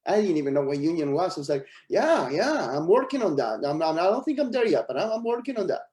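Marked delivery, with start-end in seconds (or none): 1.9-5.8 higher, swooping mock-confident reassurance; bluffing assurance. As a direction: retell a nervous bluff with big pitch swings. Bluffing about union membership.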